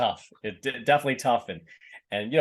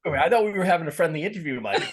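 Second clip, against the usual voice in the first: laughing, quick and a bit louder; laughing mock protest; 5 semitones higher, 4 dB louder, faster than usual. Real, not acted. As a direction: play at being hurt while laughing. Mock offence at hockey question.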